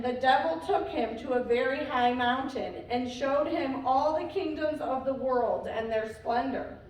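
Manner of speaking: usual speaking voice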